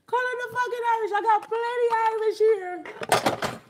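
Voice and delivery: shrill excited yelling from the laugh; excited high shout. Real, not acted. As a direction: yell it high, hyped up. Escalating repeat of his own line.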